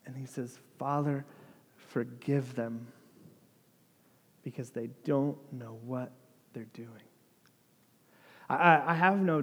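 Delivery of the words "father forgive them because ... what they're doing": soft, low, gentle prayer; compassionate plea. Retold or imitated. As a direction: plead quietly for mercy, letting the words fade. Jesus forgives those mocking him.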